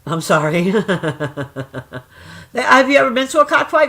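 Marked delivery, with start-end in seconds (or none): 0.8-2.0 long chuckling run; real laugh. Real, not acted. Woman apologizing to her viewer, laughing.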